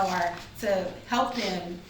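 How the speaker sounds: ordinary talk, nothing marked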